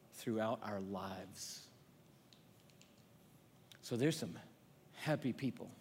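hushed reflective sermon voice; quiet sermon. Acted. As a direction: draw the crowd in close and hush. Drops into intimate teaching.

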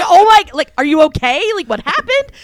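high, startled concern; alarmed onlookers. Retold or imitated. Everybody reacting to her sudden scream, mock alarm. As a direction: act out startled friends, pitch jumping up.

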